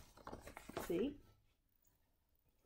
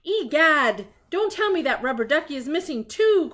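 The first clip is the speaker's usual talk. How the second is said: higher, louder shocked exclamation; alarmed cry; 6 semitones higher, 6 dB louder than usual. Acted. Sherlock reacts to the missing ducky, loud and alarmed. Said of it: "cried Sherlock".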